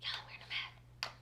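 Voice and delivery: hushed, voiceless aside; soft whispered commentary; whispering. Real, not acted. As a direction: whisper it under the video. Hushed aside while watching the video.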